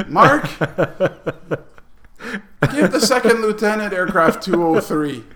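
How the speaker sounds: high, loud retold command; called-out order. Sergeant orders Mark to give over the aircraft, calling out. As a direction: call across to a subordinate, hammed up.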